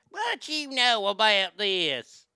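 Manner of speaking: slow, drawn-out bad Southern accent; hammed-up Southern accent, stretched words; fake Southern accent. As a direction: mimic a terrible fake Southern drawl. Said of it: "tried to do a fake Southern accent".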